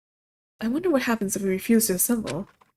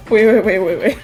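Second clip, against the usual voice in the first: loud urgent repeated wait; alarmed wait; 8 dB louder than usual. Real, not acted. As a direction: stop everything in alarm. Alarmed at execution rule.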